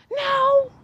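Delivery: a hint of a small child's high "No"; lightly voiced little girl. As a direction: answer like a little girl who is fine. Niece answers after falling.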